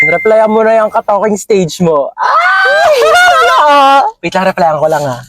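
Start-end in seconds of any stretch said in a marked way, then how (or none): 2.2-4.0 sharp high shriek of fright; startled scream. Acted. Friend startled while replying to her crush, screaming. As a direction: yelp in sudden shock. Sudden scare from the phone.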